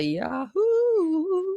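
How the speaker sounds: put-on high yodeled Yahoo like the old ad; yodel jingle. Recalling the Yahoo ad, a high yodeled call. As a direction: sing out the commercial yodel.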